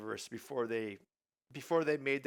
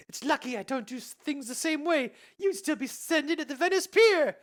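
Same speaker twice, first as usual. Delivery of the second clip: Elsa voice; 10 dB louder than usual. Acted.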